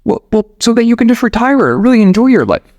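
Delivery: exasperated American tourist. Acted. Tourist making a last case to the farmer, acted exasperation. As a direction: push the pitch way up for the final argument. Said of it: "the American finally finishes it".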